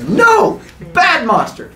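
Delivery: high, forceful scolding cry; mock scolding. Man to charging monster, high alarmed scolding. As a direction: tell off a beast in alarm.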